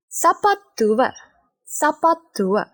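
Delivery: normal talking voice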